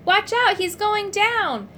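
loud high warning shout, slowing on going down. A narrator's reading. Urk shouts a warning as Edward collapses. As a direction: yell a warning as he falls. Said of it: "I heard Urk shout".

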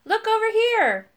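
high, loud calling out; shout. A narrator's reading. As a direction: call the others over excitedly. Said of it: "then shout".

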